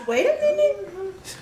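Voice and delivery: high startled call, then slowed; surprised. Real, not acted. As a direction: caught off guard by the puppy. Owner surprised by the new dog.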